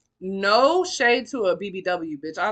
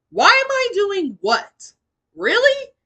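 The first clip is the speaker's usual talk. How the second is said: incredulous; 12 semitones higher, 10 dB louder, slower than usual. Retold or imitated. Incredulous reply to abuser.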